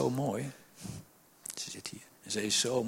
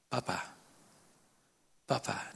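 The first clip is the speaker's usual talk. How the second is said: soft cry; 3 semitones lower, 8 dB quieter than usual. Acted. The church's cry to God.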